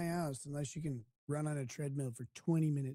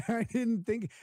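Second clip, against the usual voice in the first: laughing; 7 semitones higher, 3 dB louder, slower than usual. Real, not acted. Laughs at own tweet.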